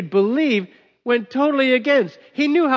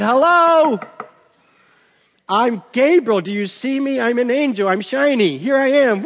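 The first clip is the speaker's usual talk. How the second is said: higher, louder, hammed-up boastful angel; showy Gabriel; 4 semitones higher, 4 dB louder than usual. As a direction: show off how shiny you are. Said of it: "what I would have done if I was Gabriel".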